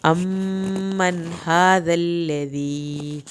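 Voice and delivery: أَمَّنْ with the m doubled and held; slow recitation, doubled m; held doubled meem. Shown as a demonstration. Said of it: "یہاں پر میم کی شد پڑھی جائے گی".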